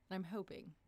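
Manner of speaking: ordinary talk, nothing marked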